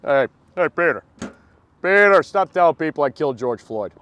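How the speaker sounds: hammed-up, high-energy cartoon impression; Family Guy character. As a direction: put on a cartoon character calling out a pal. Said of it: "those voices are all Family Guy".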